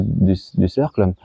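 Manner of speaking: ordinary talk, nothing marked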